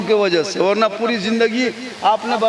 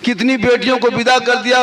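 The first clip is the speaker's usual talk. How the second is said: ordinary talk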